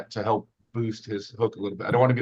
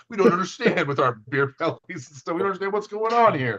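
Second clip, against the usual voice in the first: mock bewilderment; 7 semitones higher than usual. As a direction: play the baffled losers, pitch rising. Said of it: "We're like".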